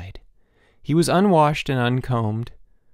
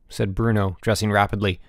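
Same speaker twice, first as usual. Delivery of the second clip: ordinary delivery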